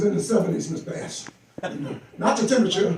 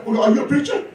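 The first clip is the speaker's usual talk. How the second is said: ordinary delivery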